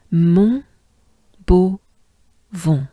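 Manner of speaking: "Mon" "beau" "vent" split, nasal "vent"; syllable by syllable. Shown as a demonstration. Teacher spelling out Montbeauvon syllable by syllable. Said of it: "Ensemble, together".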